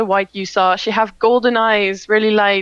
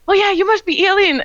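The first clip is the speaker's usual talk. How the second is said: cheerful greeting; 10 semitones higher than usual. Acted. Gaia greets Aileen.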